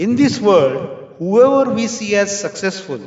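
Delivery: ordinary talk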